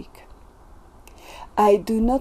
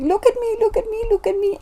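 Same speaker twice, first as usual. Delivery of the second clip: high-pitched, rapid pleas for attention; mock attention-seeking shout; 12 semitones higher, 3 dB louder, much faster than usual. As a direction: imitate products calling out, voice jumping way up high. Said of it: "they're shouting at me".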